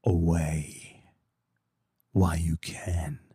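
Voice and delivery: low, slow warning inner voice; a low voice in his head urging retreat. Retold or imitated. His inner voice warning him to leave, low and slow. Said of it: "that little voice inside my head's like".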